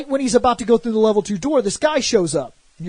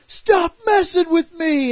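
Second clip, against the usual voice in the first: high, slow, whining mock-upset voice; whiny mock character; 7 semitones higher, slower than usual. Prompto complaining to the player, high slow whine. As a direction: whine it like a cartoon crybaby. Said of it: "And he was like".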